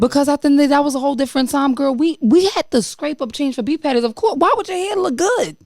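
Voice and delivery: high-pitched exasperated protest. Real, not acted. Friend arguing back about her hair, high and exasperated. Heated teasing argument over hair.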